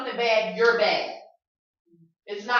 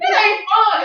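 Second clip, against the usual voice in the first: ordinary talk, nothing marked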